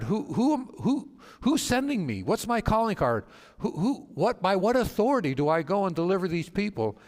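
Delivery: high, pleading Moses; voicing Moses.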